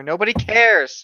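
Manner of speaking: loud dismissive snap; bratty yelling comeback; loud retort. Acted. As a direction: shout it like a brat who's done listening. Reading a bratty line.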